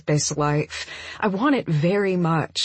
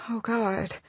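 soft, slightly higher murmur; 4 semitones higher, 6 dB quieter than usual. A narrator's reading. Woman realizing what today's date is, murmured. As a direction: murmur it under the breath. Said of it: "I murmur".

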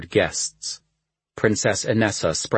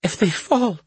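high, choked tearful voice; 14 semitones higher than usual. Acted. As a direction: force the words past a sob. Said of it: "she choked out".

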